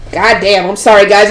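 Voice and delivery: usual speaking voice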